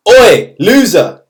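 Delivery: loud, high "oi" drawn out slowly; imitated teacher's shout. History teacher calls to a pupil walking past the classroom, mock shout. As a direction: call out sharply like a teacher hailing someone.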